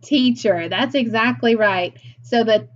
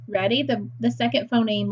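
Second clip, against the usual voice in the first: normal talking voice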